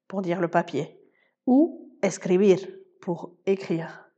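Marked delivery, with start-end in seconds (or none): 2.0-2.6 "escribir" stressed on BIR, escri-BIR; stress on last syllable. Shown as a demonstration. Spanish teacher giving learners an example, BIR stressed in "escribir". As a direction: lean on the final BIR of "escribir". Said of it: "l'accent tonique tombe sur la dernière syllabe".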